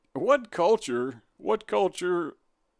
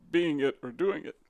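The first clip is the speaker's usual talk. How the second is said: ordinary talk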